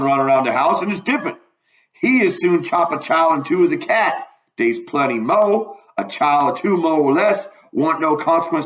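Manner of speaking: animated Southern dialect storytelling voice; lively dialect character making his case; Jim, indignant. Acted. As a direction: argue it emphatically in old Southern dialect. Voices Jim arguing about Solomon.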